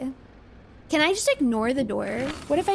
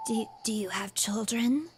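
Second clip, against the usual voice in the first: put-on creepy kid whisper; 3 semitones lower, 6 dB quieter than usual. Creepy boy whispering to the player about her eyes. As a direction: breathe it like an unsettling little boy.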